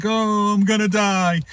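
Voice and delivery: high, loud wailing dog voice; mock dog howl. Voicing the dog howling in the back seat, high loud wail. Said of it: "this dog is a howling".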